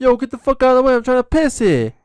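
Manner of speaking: high, loud hammed-up yell; mock angry shout. Guy shoving past to reach the urinal, a loud hammed-up yell. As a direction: bark at someone blocking the urinal.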